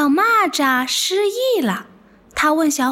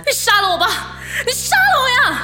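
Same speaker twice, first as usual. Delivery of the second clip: melodramatic plea; 9 semitones higher than usual. Retold or imitated. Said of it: "电视剧上的那些说".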